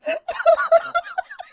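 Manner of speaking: shrieking high burst of laughter; high helpless laugh. Real, not acted. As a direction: player breaking up at the captain's threat. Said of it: "You broke me".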